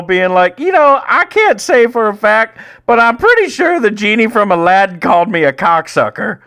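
high, strained stand-up impression; worked-up, high-pitched comedian; Bobcat Goldthwait. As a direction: perform the punchline in a loud, high comic voice. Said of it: "so this is how it goes".

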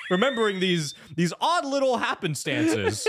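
high-pitched sarcastic mimicry; sing-song mock amazement; mocking feigned surprise. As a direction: make fun of someone's sudden realization, voice climbing high. Mocking Adam's convenient remembering.

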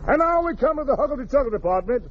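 ordinary talk, nothing marked

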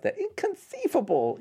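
high, slow mock Vizzini squeal; Vizzini impression. The guy from Princess Bride, high slow squeal. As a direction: stretch it out way up high in a squeaky lisp. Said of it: "I can't do the guy from Prince's Life".

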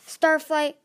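loud, impatient growl of a name. A narrator's reading. Tsunami pushes Starflight to explain, growling. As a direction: call the name sharply, in exasperation. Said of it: "Tsunami growled".

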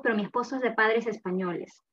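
ordinary talk, nothing marked

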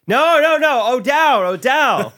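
high, loud crowd yelling back. Retold or imitated. The Jersey crowd yells the town name at the comic. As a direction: shout it like a whole crowd correcting you. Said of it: "everyone's yelling at me".

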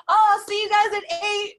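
high, loud self-mocking quote; mock breezy promise. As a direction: imitate yourself cheerfully promising to show up. Mocks own breezy promise to viewers.

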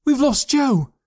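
very high, loud panicked wail; frantic cry. A narrator's reading. Girl realises Joe is missing, frantic cry. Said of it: "looking around frantically".

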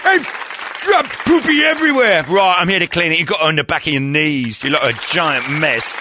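high, eager British rocker voice. Acted. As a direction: jump to it in a high, keen voice. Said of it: "Orderly Jagger".

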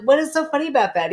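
indignant quote. Retold or imitated. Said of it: "I'm like".

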